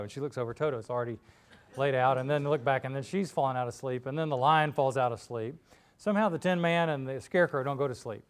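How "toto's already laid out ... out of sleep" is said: usual speaking voice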